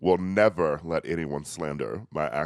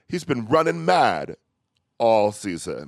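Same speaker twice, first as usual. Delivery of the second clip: British phrase; 2 semitones higher than usual. Retold or imitated. Quoting Love Island slang, lightly British accent. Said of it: "they've been saying".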